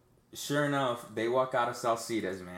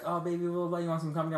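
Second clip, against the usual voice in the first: mimicked higher-pitched come-on; lightly voiced, higher hooker voice; hooker's proposition; 7 semitones higher, faster than usual. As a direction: imitate a hooker offering company. Hooker propositions him in story.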